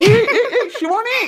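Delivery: very high, loud alarmed yell; man yelling as his girlfriend crashes into him; startled yell. Acted. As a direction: cry out as she slams into you.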